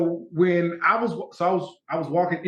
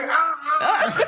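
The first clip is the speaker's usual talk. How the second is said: high laughing "ah, ah"; laughing gasp; 12 semitones higher than usual. Retold or imitated. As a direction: laugh out a shocked "ah". Laughing reaction to bearded look.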